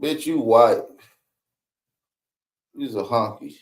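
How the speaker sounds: usual speaking voice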